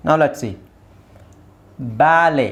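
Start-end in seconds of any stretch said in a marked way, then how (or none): 2.0-2.5 ಬ್ಯಾಲೆ with the final T left silent. Shown as a demonstration. Teacher models ಬ್ಯಾಲೆ for English learners, silent T. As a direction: say ಬ್ಯಾಲೆ slowly, ending on an open "lay". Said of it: "ಇಲ್ಲಿ ಟಿ is silent".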